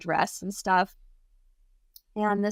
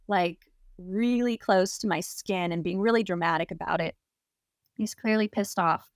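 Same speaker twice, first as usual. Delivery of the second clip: plain reading voice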